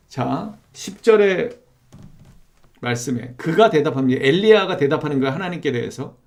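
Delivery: ordinary talk, nothing marked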